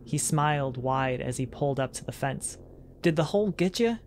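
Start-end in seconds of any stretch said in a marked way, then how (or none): 3.0-4.0 lightly voiced Southern drawl, higher; a hint of a farmer's drawl; friendly farmer asking in a light drawl. A narrator's reading. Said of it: "he asked in a drawl".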